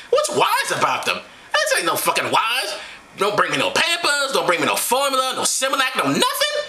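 high-pitched comic woman ranting; indignant Mary. Acted. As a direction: list the demands in a high put-on voice. Acting Mary angrily rejecting the gifts.

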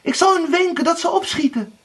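very high, excited shout; jubilant calling. A narrator's reading. Anna recognises her brothers, calling out excitedly. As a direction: call the good news down. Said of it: "riep ze een ogenblik later uit".

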